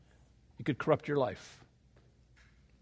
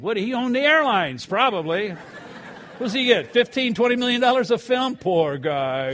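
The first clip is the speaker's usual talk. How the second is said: high, louder mock pity; laughing, mocking disbelief; sarcastic; 8 semitones higher, 6 dB louder than usual. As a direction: scoff at the rich man's modesty. Mocking Tom Cruise's modest claim.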